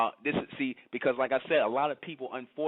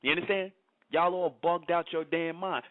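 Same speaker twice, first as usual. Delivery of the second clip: normal talking voice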